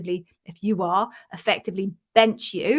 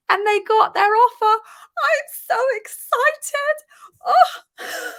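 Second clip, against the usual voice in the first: much higher, crying with happiness, slow; tearful joy; 6 dB louder, slower than usual. Real, not acted. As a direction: voice breaking with happy tears. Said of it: "I'm a little bit teary".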